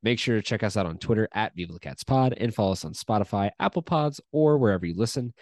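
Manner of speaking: upbeat host opening the show, starting loud; podcast intro. Acted. Host welcoming listeners to the Bearcats podcast, upbeat announcer read.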